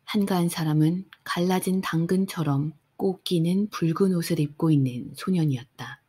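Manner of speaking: plain reading voice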